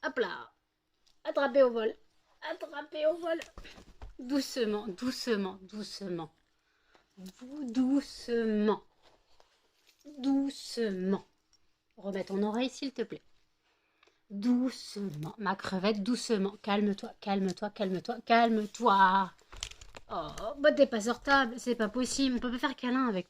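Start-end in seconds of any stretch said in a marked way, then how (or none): 4.2-11.3 very slow, drawn-out soothing. Real, not acted. Calming the excited dog. 14.3-16.6 very slow, drawn-out; slow soothing. Real, not acted. Calming the dog. 16.6-19.3 very slow and louder; slow firm calming. Real, not acted. Dog won't settle.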